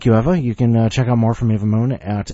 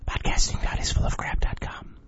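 whispering. Pretend. Whispered aside.

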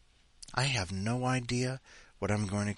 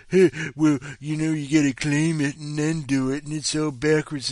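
mocking quote; 5 semitones higher, 6 dB louder than usual. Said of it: "that Empire Avenue noise of".

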